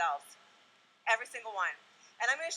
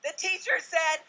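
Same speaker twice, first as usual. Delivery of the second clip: ordinary talk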